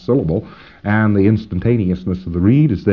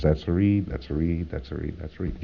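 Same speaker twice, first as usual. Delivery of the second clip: repeated reads, much softer and lower; calling reads; 8 semitones lower, 12 dB quieter than usual. Shown as a demonstration. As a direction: tick each read, trailing off. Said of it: "Give me some reads".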